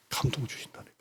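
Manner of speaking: a soft voiceless whisper. Real, not acted. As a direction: lean in and whisper it like a secret. Revealing God's comfort.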